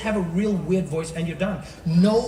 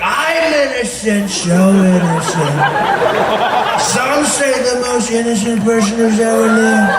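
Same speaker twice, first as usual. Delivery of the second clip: loud, slow, drawn-out villain voice; cartoon villain; 7 dB louder, slower than usual. Cartoon villain in a lineup protesting innocence, slow villain voice. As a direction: stretch every vowel like a scheming cartoon baddie. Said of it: "The man is a cartoon villain".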